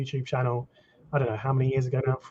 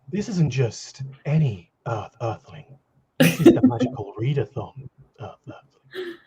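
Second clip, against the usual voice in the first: advert voiceover. Retold or imitated. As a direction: sell it like a TV ad narrator. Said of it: "M N S Advert".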